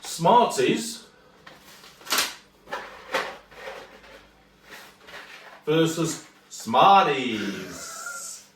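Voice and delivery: put-on announcer-style American accent, drawn out very slowly. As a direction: stretch out "versus" in an American accent. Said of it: "that was the American accent".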